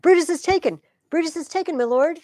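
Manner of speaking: high, loud announcement of a catch; excited shout. Acted. Soldier to Antony, shouting the news. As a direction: cry the news to the general.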